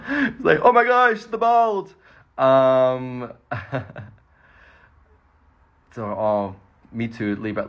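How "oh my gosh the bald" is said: high, loud gasp of fake horror; hammed-up shocked squeal; mock shock. Mock shocked fan reaction.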